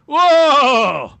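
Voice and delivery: high, loud mock Hank Hill whoa; Hank Hill impression. Correcting a friend's Hank Hill impression. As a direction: belt a cartoonish whoa. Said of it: "No, he goes like this".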